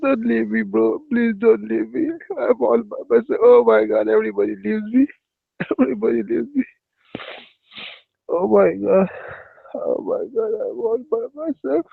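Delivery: high, whiny mock begging; high mock whine, don't leave me; whiny pleading. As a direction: whimper like an abandoned child. Whiny begging after being left.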